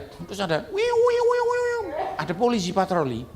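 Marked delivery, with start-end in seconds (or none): 0.7-1.8 high wailing wiu wiu like a police siren; playful high siren noise; siren imitation. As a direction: mimic the patrol car siren, pitched way up.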